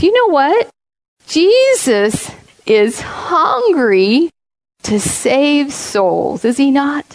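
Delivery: very slow, drawn out; preaching emphasis. Real, not acted. Preacher urging her congregation on, slow and weighty. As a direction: lean hard on hungry to save souls.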